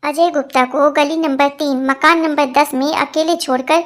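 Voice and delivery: ordinary talk, nothing marked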